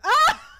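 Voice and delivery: a sudden yelp dissolving into laughter; jump, then laugh it off; startled laugh and yelp. Real, not acted. Gamer spooked in the coffin, startled laughing yelp.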